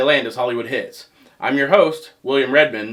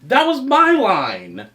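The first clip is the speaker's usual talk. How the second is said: high, drawn-out indignant yell; indignant protest; 9 semitones higher, 4 dB louder, much slower than usual. Acted. Host robbed of his catchphrase, hammed-up indignant whine. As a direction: protest at having your big moment stolen, slow and high.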